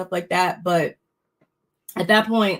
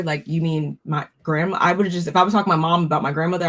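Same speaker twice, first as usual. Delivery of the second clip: ordinary delivery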